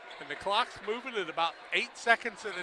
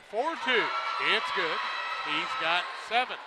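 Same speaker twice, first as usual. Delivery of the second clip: ordinary talk, nothing marked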